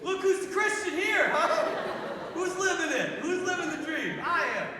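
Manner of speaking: loud, high, boastful celebration; mock triumphant crowing. Priest congratulating himself on the Walkovator, mock triumphant. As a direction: crow about yourself in hammed-up triumph.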